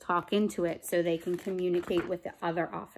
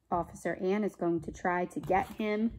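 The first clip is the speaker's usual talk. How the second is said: plain reading voice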